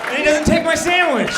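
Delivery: loud, high outraged yell; a comic's outraged yell; outraged shout. Real, not acted. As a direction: shout it in disbelief. Outraged punchline about the sandwich.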